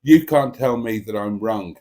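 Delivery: ordinary delivery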